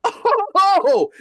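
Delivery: high, loud startled yelp; loud, high-pitched mock shock; shocked reaction. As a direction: re-enact a big jolt of alarm. Acting out his shock at demon.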